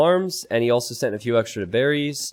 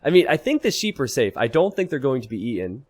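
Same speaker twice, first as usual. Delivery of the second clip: usual speaking voice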